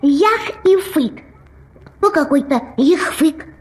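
mushy lisp; swollen tongue. Acted. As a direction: fat tongue slurring. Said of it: "всё получалось".

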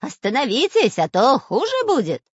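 high, loud villainous threat; ghastly shout. A narrator's reading. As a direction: threaten them in a horrible spy voice. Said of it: "кричал жутким голосом шпион Дырка".

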